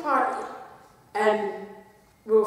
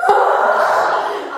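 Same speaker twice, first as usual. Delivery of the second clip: panicked gasp; 4 semitones higher, 7 dB louder than usual. Retold or imitated. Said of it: "I've never seen a panic".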